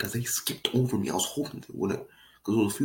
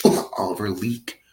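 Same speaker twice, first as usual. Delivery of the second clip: ordinary talk, nothing marked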